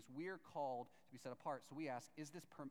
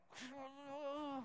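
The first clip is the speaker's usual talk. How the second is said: laugh; 8 semitones higher than usual. Retold or imitated. Brad laughing at the reply.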